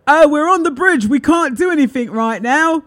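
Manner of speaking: loud mock announcement; hammed-up shouting conductor. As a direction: bark it out like an overexcited conductor. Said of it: "Not like that, but, you know, like that".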